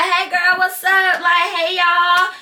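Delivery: high, bright hello to the room; cheerful Southern hello; bubbly greeting. Shown as a demonstration. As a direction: greet everyone like old friends. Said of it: "I'ma greet you like".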